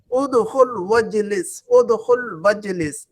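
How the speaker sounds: "उदखुल वजलिस" slow, "वजलिस" with the hamza of "इजलिस" dropped; slow linked reading. Shown as a demonstration. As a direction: spell it out slowly, joining "व" to "जलिस". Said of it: "हम कहेंगे उदखुल वजलिस". ("उदखुल वजलिस" धीरे धीरे, जोड़कर)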